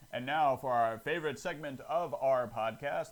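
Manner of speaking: big showbiz announcer intro. Acted. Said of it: "You like that announcer voice?".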